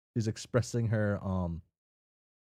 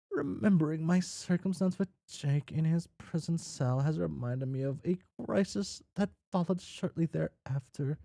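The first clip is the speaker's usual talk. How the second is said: story narration; 10 semitones higher than usual. Acted. Character recalls events in game.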